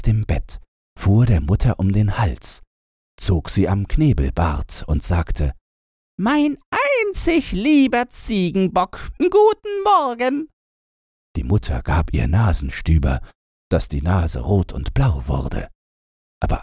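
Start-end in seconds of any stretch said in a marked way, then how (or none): 6.2-10.5 very high, cooing greeting; sweet-talking girl. A narrator's reading. Robber girl greets her mother, very high sweet voice.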